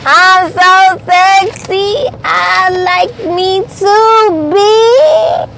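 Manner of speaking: a hammed-up, slow self-admiring drawl; vain diva. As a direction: draw it out, full of yourself.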